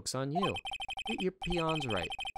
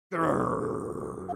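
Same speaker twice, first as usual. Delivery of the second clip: tiger growl; 5 dB louder than usual. Acted. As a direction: growl like a big cat.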